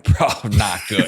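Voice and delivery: laughing, slowed down; sheepish laughing confession. Retold or imitated. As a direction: laugh through the admission. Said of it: "And I'm like".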